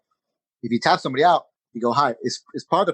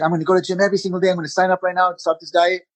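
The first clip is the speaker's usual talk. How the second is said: fast, higher, eager mock vow; hyped-up resolution; 5 semitones higher than usual. Mocking someone's New Year's resolution, fast and hyped. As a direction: rattle it off like a New Year's high. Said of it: "when you're on a high".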